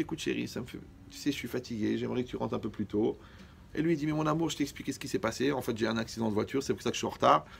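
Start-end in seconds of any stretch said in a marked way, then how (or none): 0.0-3.2 voix bien plus douce, presque chuchotée, pour l'épouse patiente. Acted. Wife's patient words voiced softly. 4.0-7.5 excuse douce. Acted. Husband's gentle apology voiced.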